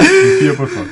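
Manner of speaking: high laughing plea; begging through laughter. Real, not acted.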